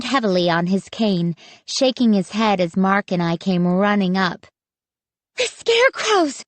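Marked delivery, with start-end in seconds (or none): 5.4-6.4 breathless exclaim. A narrator's reading. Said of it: "I exclaimed breathlessly".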